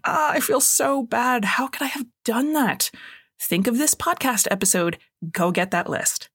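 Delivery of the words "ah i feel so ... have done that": mildly pained, higher-pitched self-reproach; lightly acted remorse; wincing regret. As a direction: wince through it, acting out feeling bad. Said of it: "wincing, saying".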